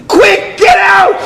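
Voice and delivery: high, loud, stretched-out order; urgent shout. Retold or imitated. Taxi driver at the emergency entrance, shouting to the couple. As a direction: shout them out of the car.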